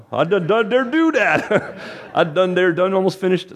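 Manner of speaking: high giggling through "I done, done dare do that"; laughing at his own tangled words; real laughter breaking into the words; laughing at own slip. Real, not acted. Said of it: "what kind of English is that".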